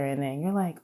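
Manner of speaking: normal talking voice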